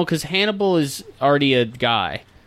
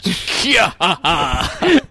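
slow evil villain cackle; 5 semitones higher, 3 dB louder, much slower than usual. Pretend. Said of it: "Can you do that in a more evil voice?".

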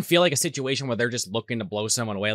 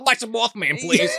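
playful shout; 10 semitones higher than usual. Real, not acted. Joking shout in banter.

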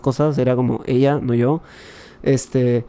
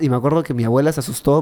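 ordinary talk

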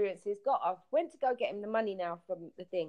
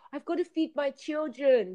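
mock pleading camel man; 6 semitones higher than usual. Camel man begging for more money.

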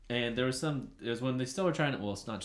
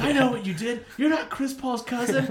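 high-pitched, loud taunt from the stands; retold heckling yell; 13 semitones higher, 8 dB louder than usual. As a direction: re-enact shouting an accusation at a player. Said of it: "the whole time I was yelling".

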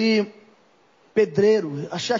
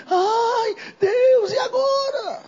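high, crying wail, cracking into a cry on Deus; mock crying lament; 11 semitones higher, much slower than usual. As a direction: imitate a believer whining and weeping to God. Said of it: "Não dá tempo de eu ficar chorando". (imitação de choro lamentoso, agudo e exagerado)

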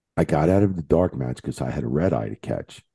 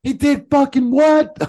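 much higher, slow and louder retold outrage; stunned disbelief; 5 dB louder, slower than usual. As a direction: drag out the disbelief as if you can't believe it. Retelling shocked reaction again.

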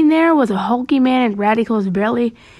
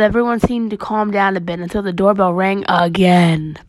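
plain reading voice

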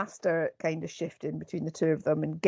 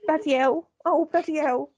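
high, worried put-on Londoner; meek character impression; 8 semitones higher than usual. Co-host as character fearing madness, high and meek. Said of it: "I talk like this".

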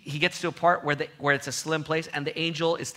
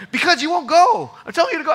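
loud, much higher exasperated retort; arguing; 13 dB louder than usual. Retold or imitated. Balaam argues with his donkey, in a loud, high exasperated retort. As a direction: snap back at the stubborn donkey. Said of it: "He starts arguing with the donkey".

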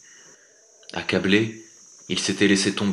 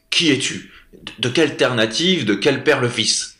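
loud, raised angry questioning; angry tsar; 5 semitones higher, 5 dB louder than usual. Acted. The tsar Kousman shouts at the horse thief. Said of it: "Le tsar cria, très en colère".

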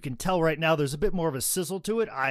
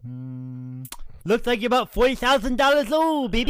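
Ric Flair impression; 8 semitones higher, 6 dB louder than usual. Ric Flair voice, high and loud.

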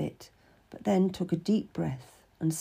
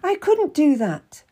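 raised and louder, startled protest; crying out in alarm; 9 semitones higher, 7 dB louder than usual. Acted. Cat protests to Catherine about spying on the Queen, alarmed outcry. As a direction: recoil from the very idea. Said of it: "I cried out".

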